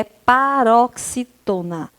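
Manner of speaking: "paroxítona" broken slowly into syllables; teacherly, slow dictation of "paroxítona". Shown as a demonstration. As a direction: draw out each syllable of "paroxítona" for the class. Teacher spells out key term by syllables.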